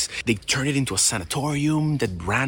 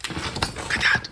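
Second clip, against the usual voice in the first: whispering; 4 dB quieter, slower than usual. Real, not acted. Spotting something in dark.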